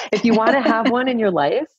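half-laughing mock manual; laughing instructions. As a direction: giggle out the terms of use. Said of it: "almost like the operating instructions".

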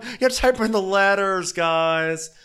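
high-pitched mock impression, rushing at the start; hammed-up high voice, easing down at the end; Kyle. Mocking Kyle explaining flip phones to everyone, high hammed-up voice. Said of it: "And Kyle's like".

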